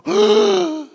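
loud, high mock gasp; hammed-up gasp of horror; shocked gasp. Churchgoer watching a page get ripped out, acted shocked gasp. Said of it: "watching somebody's eyebrows go".

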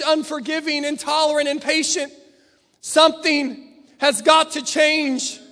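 forceful, emphatic preacher; preaching at full force. Real, not acted. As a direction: drive each charge home to the congregation.

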